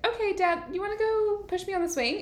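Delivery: put-on high-pitched child asking daddy; mock-innocent little girl voice. Imitating the sweet little girl.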